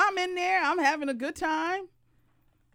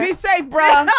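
louder bro farewell; frat bro; 8 dB louder than usual. Retold or imitated. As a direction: send him off like a bro. Bro voice continues.

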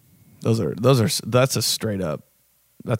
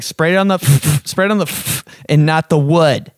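mom instructing; 5 semitones higher, 4 dB louder than usual. Retold or imitated. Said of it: "your mom was always like".

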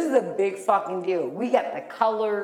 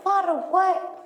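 much higher, startled question; shocked reaction; 12 semitones higher than usual. Retold or imitated. Friend reacting to the prom photo drama, startled. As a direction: sound caught off guard.

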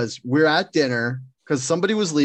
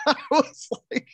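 laughing; 3 dB quieter than usual. Real, not acted. Laughs into the line.

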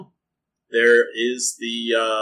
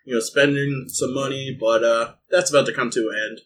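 ordinary talk, nothing marked

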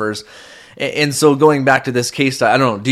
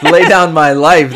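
louder, laughing it off as he fixes the slip; flustered correction; 5 semitones higher, 9 dB louder than usual. Real, not acted. Husband to wife, loudly correcting his slip. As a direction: blurt the right words out after a gaffe. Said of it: "Oh, sorry".

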